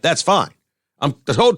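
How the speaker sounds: ordinary talk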